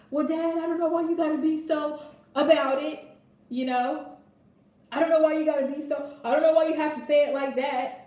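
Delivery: teenage son complaining to his dad, mock whine; whiny teenage son. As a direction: play the sulky teen complaining to dad. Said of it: "his son's like".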